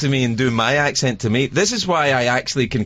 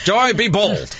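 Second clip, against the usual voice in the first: mock boss advice; 6 semitones higher, much slower than usual. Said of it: "mock repeat of advice".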